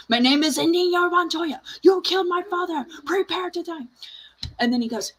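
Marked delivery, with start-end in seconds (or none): 0.1-3.9 higher and louder, in a changed voice; furious repeat. Retold or imitated. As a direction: hammer it home in growing fury. Inigo repeats line, rising fury.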